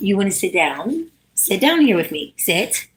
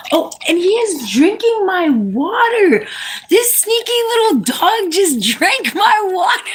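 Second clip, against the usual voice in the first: high-pitched, laughing disbelief; playfully scandalized, pitch soaring into a laugh; mock outrage; 10 semitones higher than usual. Real, not acted. Mock outrage at dog stealing water.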